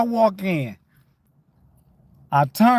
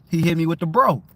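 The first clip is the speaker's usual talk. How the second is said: usual speaking voice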